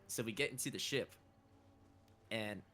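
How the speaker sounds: ordinary talk, nothing marked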